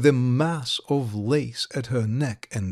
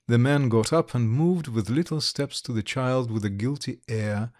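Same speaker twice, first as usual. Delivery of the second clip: plain reading voice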